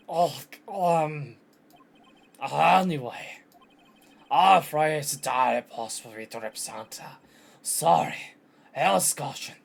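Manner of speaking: loud, put-on flustered boss voice; pompous caricature in a wobbly accent; blustering old lawyer. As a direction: refuse with loud, awkward bluster. Voices Marvin Grosberg refusing the case.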